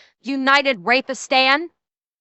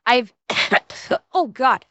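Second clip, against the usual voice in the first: ordinary talk, nothing marked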